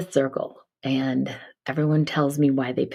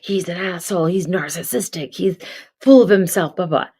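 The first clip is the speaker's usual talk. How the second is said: mock rant; 4 semitones higher, 5 dB louder than usual. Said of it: "quality that you hate in that politician".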